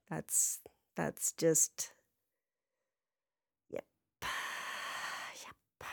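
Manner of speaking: whispered, slow and resigned; trailing off with a defeated sigh. Real, not acted. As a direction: let it fade to a tired whisper. Resigned sigh after rant.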